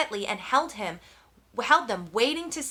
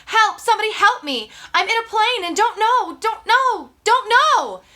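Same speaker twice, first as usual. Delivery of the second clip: panicked scream, much higher and louder; screaming for help; 10 semitones higher, 9 dB louder than usual. Acted. Brian screaming into the radio for help, panicked shout. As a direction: shout it out in terror, over and over. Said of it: "he began to scream into the microphone".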